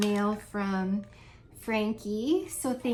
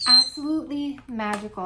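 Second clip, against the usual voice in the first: louder, thrilled praise; gushing delight; 3 semitones higher, 8 dB louder than usual. Real, not acted. Reviewer raving to viewers about the honey melt, thrilled and louder. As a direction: rave about it with real delight.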